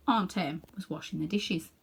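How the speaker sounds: plain reading voice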